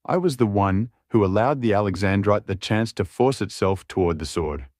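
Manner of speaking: plain reading voice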